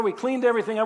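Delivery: normal talking voice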